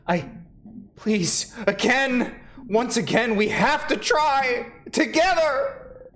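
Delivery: high, slow pleading; urgent plea. Acted. Pleading with the party, high and urgent. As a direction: beg them to try as one.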